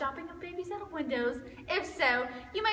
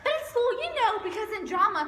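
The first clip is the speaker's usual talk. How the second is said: ordinary talk, nothing marked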